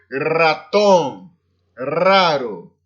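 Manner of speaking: "ratón, raro" with a strongly rolled initial R; vibrant trilled R. Shown as a demonstration. Teacher showing the always vibrant R, trilled "ratón, raro". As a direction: roll the R hard in "ratón, raro". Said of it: "o R sempre vibrante".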